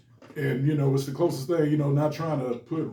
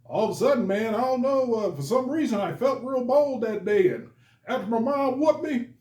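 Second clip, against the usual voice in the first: put-on much higher retelling of a bold kid; retold coworker; 9 semitones higher than usual. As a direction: tell it with rising disbelief. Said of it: "And he said".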